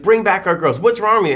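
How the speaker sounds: loud, high mock outrage; self-righteous. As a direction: parody an indignant activist scolding everyone. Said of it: "self-righteous tone of voice that everybody got".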